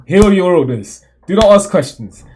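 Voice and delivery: a hint of a blunt order; curt commander. Reading the scans' version as a curt order. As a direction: straight to the point, lightly barked. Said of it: "seemed a little bit more like".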